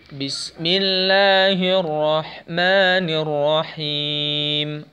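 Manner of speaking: slow "Bismillahirrahmanirrahim" with clear breathy ha; clean recitation. Shown as a demonstration. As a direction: recite slowly, let the ha sound clean. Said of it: "harus bersih suaranya".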